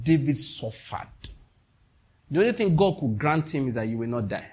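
ordinary talk, nothing marked

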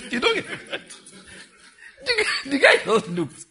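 high, slow words through laughter; laughing narration. Real, not acted. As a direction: keep laughing as you tell it. Narrating the scene, laughing hard.

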